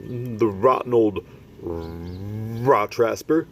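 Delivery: slow, drawn-out indignant giant; mild indignant cry. Acted. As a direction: spit out the insult slowly. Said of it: "cried the B F G".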